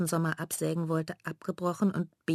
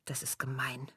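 soft, voiceless whisper, lower; hurt whisper; 4 semitones lower, 9 dB quieter than usual. Acted. Mela stung by her sister's insult, hurt whisper. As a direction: barely breathe it, wounded.

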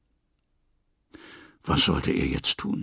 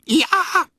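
high, loud cross bray; angry braying; 6 dB louder than usual. A narrator's reading. The hungry donkey finds no oats, braying angrily. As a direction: bray in annoyance at the empty page. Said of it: "schrie er ärgerlich".

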